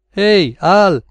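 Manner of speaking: high, loud hail; exclaimed call. A narrator's reading. Max to Al out in the kitchen, calling out. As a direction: call out to your partner in the next room. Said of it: "esclamò Max".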